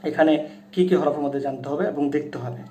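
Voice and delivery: ordinary delivery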